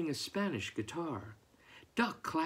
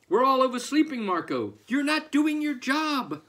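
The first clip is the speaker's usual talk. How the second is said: scolding the rooster; 10 semitones higher, 7 dB louder than usual. Acted.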